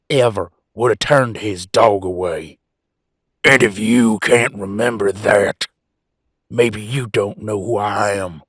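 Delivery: loud, slow, forceful anger; mounting anger. Acted. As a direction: bear down hard on each word, getting angrier. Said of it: "I'm starting to get real frickin' angry".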